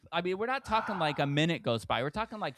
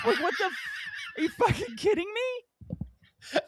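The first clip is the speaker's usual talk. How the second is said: much higher, louder disbelief; outraged; 3 dB louder than usual. Real, not acted. Telling friend about rude waiter, outraged high disbelief. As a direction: squeal it in outrage.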